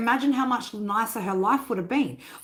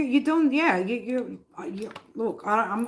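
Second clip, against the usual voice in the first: ordinary talk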